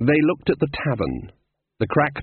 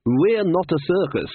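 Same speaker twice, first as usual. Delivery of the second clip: high, chirpy reply; bright; 7 semitones higher than usual. A narrator's reading. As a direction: brightly reassure the hostile locals. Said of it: "said Glod brightly".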